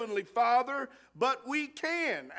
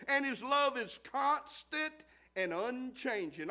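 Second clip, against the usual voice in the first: ordinary talk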